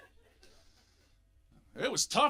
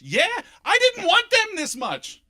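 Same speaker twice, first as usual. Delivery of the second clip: loud, high, drawn-out comic yell; shouted retort; 13 semitones higher, 10 dB louder, slower than usual. Acted. Husband answering his wife about the kids, exasperated shout. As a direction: fire back at full volume, stretching the words.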